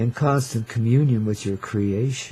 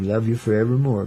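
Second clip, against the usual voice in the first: ordinary talk, nothing marked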